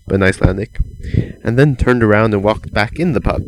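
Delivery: ordinary delivery, nothing marked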